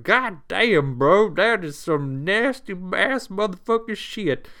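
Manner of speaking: high, loud Southern drawl, disgusted; hammed-up Southern griping about the mess; Southern twang ranting at nasty grime. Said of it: "the southern twang".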